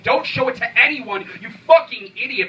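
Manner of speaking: desperate shouted warning; screaming at himself. Acted.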